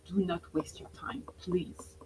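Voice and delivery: soft urgent whisper. Real, not acted. Coach to webinar audience, whispered plea. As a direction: lean in and whisper the warning.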